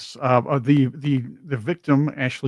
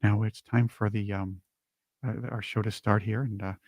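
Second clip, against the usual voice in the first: NPR girls impression; 5 semitones lower, 8 dB quieter than usual. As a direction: ease into it like a hushed public-radio host. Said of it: "these two nice ladies here from Saturday Night Live".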